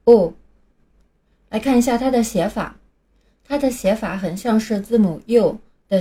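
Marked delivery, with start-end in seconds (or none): 0.0-0.3 a brief, louder model of the vowel "O"; short phoneme demo. Shown as a demonstration. Teacher models the first phonetic symbol, short "O". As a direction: say "O" as a short, clipped lax vowel, lips relaxed. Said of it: "先来看第一个音标".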